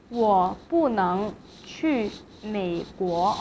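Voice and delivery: slow, syllable by syllable, 不 falling before 能; fourth tone on 不. Shown as a demonstration. Teacher giving the second example, slow falling tone on 不. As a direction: say it slowly and drop 不. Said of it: "不 in fourth tone".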